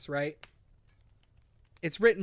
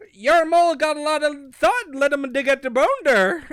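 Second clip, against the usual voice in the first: loud, high mock-Cockney mole voice; exaggerated broken Cockney, pitched up; 6 semitones higher, 7 dB louder than usual. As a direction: do a hammed-up Cockney yokel mole. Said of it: "the moles speak in this Cockney tongue".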